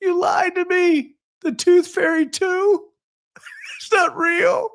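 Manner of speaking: very high, slow wailing accusation; high slow mock wail of a child. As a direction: cry out betrayed like a little girl. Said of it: "she starts wailing".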